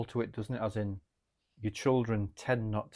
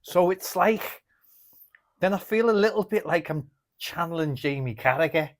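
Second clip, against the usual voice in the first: easing into a louder Scouse accent; 4 semitones higher, 5 dB louder than usual. Shown as a demonstration. Showing a friend an accent as a mask, getting into Scouse. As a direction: try on a Scouse accent, laughing a little. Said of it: "get into the accent of Scouse".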